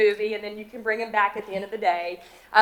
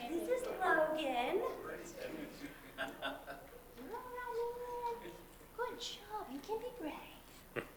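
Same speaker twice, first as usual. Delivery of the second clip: high, much softer sweet talk to a nervous dog; soft coaxing to a dog; 9 semitones higher, 18 dB quieter, slower than usual. Real, not acted. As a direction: coax the scared dog gently, slow.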